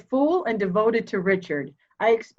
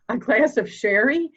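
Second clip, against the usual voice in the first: scornful laugh; 4 semitones higher than usual. Acted. Mother scoffs at inspector's claim.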